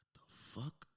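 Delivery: whispered disbelief; stunned whisper. Retold or imitated. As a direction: whisper it as a baffled aside. His stunned reaction, acted.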